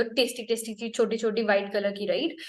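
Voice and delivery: ordinary talk, nothing marked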